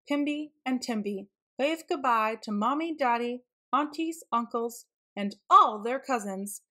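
ordinary delivery

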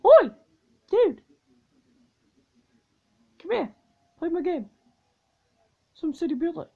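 high, loud nagging mock voice; mock tablet message. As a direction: whine like a pushy app. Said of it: "message saying something like".

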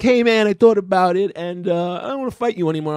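high, quickening meek backing-out voice; mock timid kid. Kid backs out of fight.

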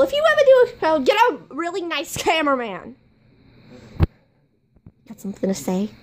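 ordinary delivery

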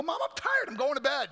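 put-on high, loud teenage whine to mom; acted exasperated complaint; irritated brush-off. Acted brush-off to his mother.

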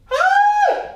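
very high, loud squeal; excited squeal. Acted. Showing partner the army gear, excited squeal. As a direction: squeal with sudden delight. Thrilled about army clothing.

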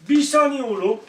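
high, loud call to hold the dog back; sharp call to the dog. Real, not acted. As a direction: stop the dog mid-move, loud and high. Owner calming the dog.

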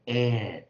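"air" held long and loud with a final r; drawn-out model sound. Shown as a demonstration. Teacher shows how E R is read, drawn-out model sound. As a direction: stretch "air" loudly into the r. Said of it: "E R我们念".

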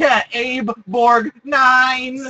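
slow, loud drawn-out call; drawn-out shout. Acted. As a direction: bellow the name out slowly. Shouting the cyborg name.

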